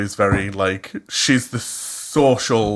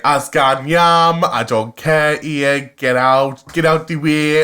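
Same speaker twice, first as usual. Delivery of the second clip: lightly put-on broad Cumbrian accent, slow; a hint of thick Cumbrian dialect, drawn out; friend's broad Cumbrian, playfully mimicked; 4 semitones higher, slower than usual. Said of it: "I go super Cumbrian".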